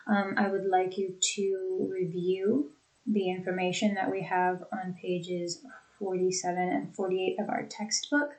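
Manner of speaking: normal talking voice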